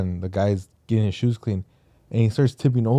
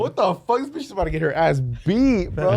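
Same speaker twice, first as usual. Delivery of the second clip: higher, incredulous; watching the fall, mock outrage; 7 semitones higher than usual. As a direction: react in disbelief. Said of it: "I was like".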